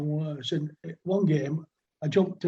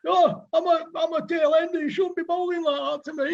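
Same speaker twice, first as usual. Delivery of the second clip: much higher, whiny mock complaint; complaining tailender; 11 semitones higher than usual. Batter whining to the bowler in a much higher mock voice. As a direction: mimic a batter's whining protest, pitched way up. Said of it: "And he would complain".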